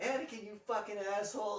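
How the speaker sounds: high, laughing mock scolding of Anakin; mock Mace Windu. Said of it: "Mace Windu will be like".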